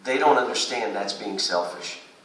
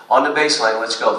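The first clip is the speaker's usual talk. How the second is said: usual speaking voice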